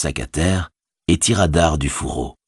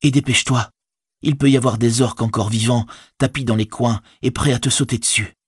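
higher-pitched, cautioning hobbit; anxious Frodo; 7 semitones higher than usual. A narrator's reading. Hobbit cautioning his departing servant, higher and concerned. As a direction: warn him in a raised pitch.

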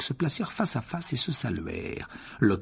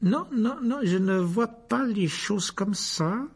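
cold, very slow refusal; icy; 10 semitones higher, slower than usual. A narrator's reading. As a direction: refuse with a chilly smile. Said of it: "avec un sourire glacial".

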